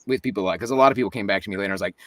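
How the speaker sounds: ordinary talk, nothing marked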